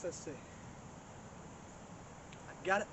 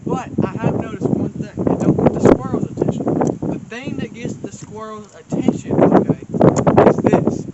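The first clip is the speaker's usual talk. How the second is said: suddenly much louder, almost shouting; loud announcement; 17 dB louder than usual. Real, not acted. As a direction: belt it out like calling across the woods. Loud pitch over squirrel noise.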